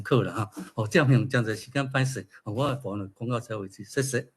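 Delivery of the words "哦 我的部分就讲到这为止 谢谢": ordinary talk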